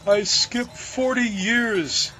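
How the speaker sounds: a hint of tearful pleading; in tears. A narrator's reading. Baker telling crew his woe, mildly tearful and slow. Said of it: "said the baker in tears".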